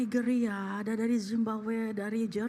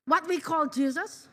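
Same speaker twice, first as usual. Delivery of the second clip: ordinary talk, nothing marked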